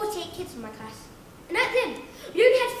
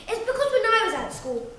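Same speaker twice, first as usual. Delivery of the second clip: usual speaking voice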